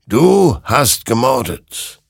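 slow, high, loud verdict; heavy, drawn-out accusation; accusing spectral rider. Acted. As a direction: pronounce guilt, word by word.

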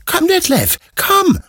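very high, eager little dog calling; excited puppy voice. Acted. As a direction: squeal it like an overexcited puppy. Excited puppy calls his owner.

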